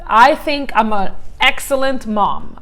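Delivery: normal talking voice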